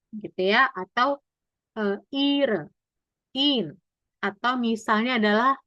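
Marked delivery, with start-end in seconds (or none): 2.1-3.8 "ir", "in" with long ee; lengthened vowel. Shown as a demonstration. Teacher showing I H words, drawn-out ee with silent H. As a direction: stretch the I in "ir" and "in". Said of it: "lebih panjang huruf vokalnya".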